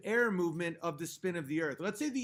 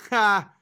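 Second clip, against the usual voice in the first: one sudden, loud gloating laugh; short, loud crow of victory; loud triumphant "ha"; 10 dB louder than usual. Real, not acted. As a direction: burst out a single scornful "Ha!". Gloats at opponent in debate.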